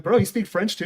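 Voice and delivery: mock astonishment, voice lifted; retold surprise. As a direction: ask it as an amazed buddy. Retold surprise at French speaker.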